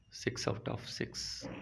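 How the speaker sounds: whispered repeat of the score; quiet whispered aside to yourself. Real, not acted. Repeats the score under breath.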